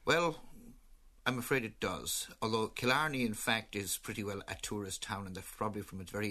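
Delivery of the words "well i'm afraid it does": usual speaking voice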